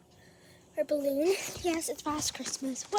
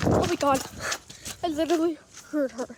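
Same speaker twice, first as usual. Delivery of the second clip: panicked; 11 dB louder, faster than usual. Real, not acted. Nearly caught sneaking.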